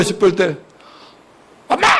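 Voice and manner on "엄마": the wrong way, a quick angry call; sharp yell at mother. Shown as a demonstration. Pastor showing the wrong way to call mom in anger. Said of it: "그러지 마".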